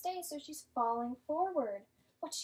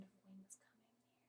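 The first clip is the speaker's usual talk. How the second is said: hushed storytelling whisper; hushed, secretive read-aloud whisper; suspenseful whisper; 4 semitones lower, 28 dB quieter than usual. A narrator's reading.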